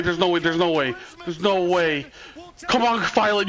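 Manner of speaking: normal talking voice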